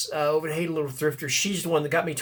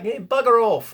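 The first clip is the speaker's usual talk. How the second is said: raised-pitch mock-British brush-off; hammed-up, poorly imitated English accent; bad English accent; 5 semitones higher, 3 dB louder than usual. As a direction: dismiss them in a bad UK accent. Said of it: "that was a horrible English, uh, uh, uh, U K accent".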